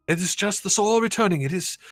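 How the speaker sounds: high, solemn priestly voice; abbot voice. Acted. Abbot heals the boy, high solemn priestly voice. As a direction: intone it like a devout old abbot praying.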